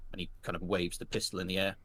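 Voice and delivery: ordinary talk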